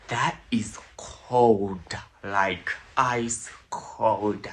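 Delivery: drawn-out hushed verdict; slow whisper. Real, not acted. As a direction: savor it slowly, hushed.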